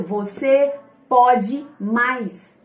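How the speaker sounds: very slow, weighted exhortation; deliberate, drawn-out encouragement; mentor's urging. Retold or imitated. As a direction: drag out each word like a mentor pushing. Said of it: "vai falar o tempo inteiro".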